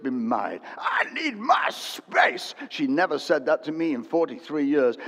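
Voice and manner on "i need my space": high, slow, shouted mock whine; mocking wife's voice. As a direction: ham up a wife's demand. Wife's line mocked in a sermon.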